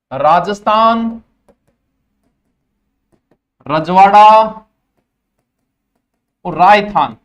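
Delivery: spaced and loud, one word at a time; slow dictation. Shown as a demonstration. Teacher dictating three names to students, slow and loud. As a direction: spell it out for their notebooks. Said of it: "अब बात लिखनी है".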